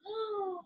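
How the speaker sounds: soft shocked gasp; a quick breath in of horror. Acted. Raja gasps at his mustache, soft and shocked.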